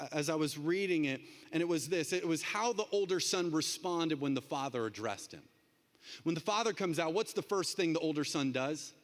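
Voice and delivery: ordinary talk, nothing marked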